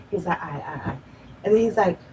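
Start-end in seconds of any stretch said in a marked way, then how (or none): 0.4-1.0 very quiet, low imitated reply; subdued reassurance. Partner fending her off in bed, low and hushed. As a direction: murmur it low, placating.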